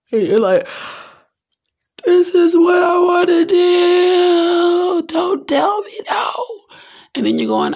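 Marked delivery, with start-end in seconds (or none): 2.0-6.3 high, slow, loud wailing tantrum; mock crying tantrum, much higher and louder; whiny demanding cry. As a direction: wail it like a spoiled child.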